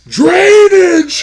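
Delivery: huge, high theatrical shout; over-the-top shouted film quote; hammed-up villain bellow. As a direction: bellow it like a raging movie tyrant. Bellowing Daniel's famous word.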